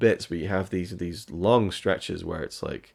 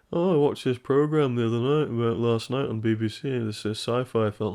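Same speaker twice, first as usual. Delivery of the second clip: a lightly put-on, higher accent as a Beatle chatting; half-hearted attempt at a Beatle's accent, chatty; bad accent; 3 semitones higher than usual. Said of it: "I can't do a very good accent".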